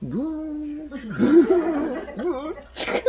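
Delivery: soft droning buzz with a sting at the end; lightly voiced insect drone mocking weak singing; mock mosquito buzz. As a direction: hum it like a mosquito, then a little prick on チクッ. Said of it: "その賛美たるやもうね".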